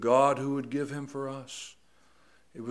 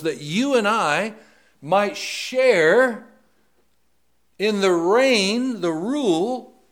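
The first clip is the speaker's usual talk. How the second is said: fervent preaching; 7 semitones higher, 11 dB louder than usual. Acted. Preacher stirring the congregation about God's gift, fervent. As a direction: build the fervour and ring it out.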